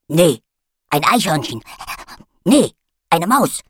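loud, eager puppy on a scent; excited dog voice. A narrator's reading. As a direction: sniff out the trail, all excited. Dog character guessing scents.

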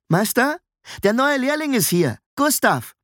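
put-on high boyish call through a door. A narrator's reading. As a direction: announce the newcomer to someone inside. Apprentice calls through coach door.

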